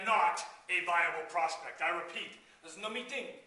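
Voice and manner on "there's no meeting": light mock French accent; lightly put-on French accent. Shown as a demonstration. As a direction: say it with a playful hint of French. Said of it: "This is my French accent".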